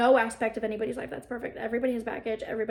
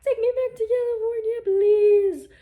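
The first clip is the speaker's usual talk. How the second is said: high, whining plea; high sing-song whine for home; whiny plea; 10 semitones higher, 6 dB louder than usual. Real, not acted. Whining about missing California.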